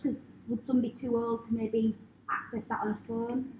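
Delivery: normal talking voice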